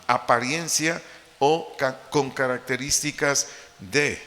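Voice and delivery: ordinary delivery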